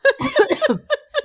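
high choking cough with laugh. Real, not acted. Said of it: "até chorei".

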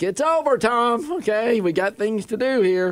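high-pitched impatient wife. Retold or imitated. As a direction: pitch up, brisk, ending it. Voicing wife ending the hug.

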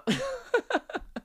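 high, soft giggle; laughing. Real, not acted. Podcaster laughing at his own insecurities, high giggle. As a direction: giggle helplessly, high and quiet.

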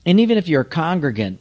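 ordinary talk, nothing marked

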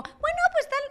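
squeaky put-on falsetto; imitated woman's voice. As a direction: imitate a man putting on a woman's voice. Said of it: "Él habló así, dijo como".

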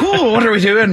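high, loud Lucky Charms Irish; bad Irish accent. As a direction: ham up a cartoon leprechaun brogue. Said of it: "mine's like a Lucky Charms kinda".

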